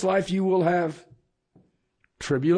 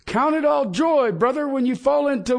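preaching scripture loudly; 10 semitones higher, 5 dB louder than usual. Acted.

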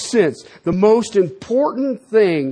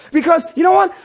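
preacher's shout; 7 semitones higher, faster than usual. Real, not acted. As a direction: throw it out to the room. Preacher calls out to congregation.

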